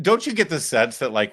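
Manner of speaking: ordinary delivery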